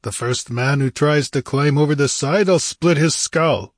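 hero's stern warning; threatening promise. A narrator's reading. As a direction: warn them off firmly. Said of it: "promised Conan".

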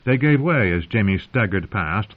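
ordinary delivery

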